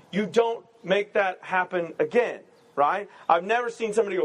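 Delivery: normal talking voice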